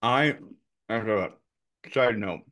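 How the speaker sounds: talking with sour candy in mouth; eating sour candy. Real, not acted. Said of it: "'Cause I'm done with that sour candy".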